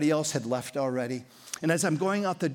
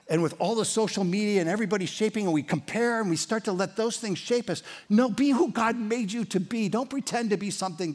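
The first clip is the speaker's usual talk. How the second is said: rising higher and louder, pressing faster; fervent preaching; 7 semitones higher, 3 dB louder than usual. Acted. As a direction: preach it with building fire. Preacher building to a peak.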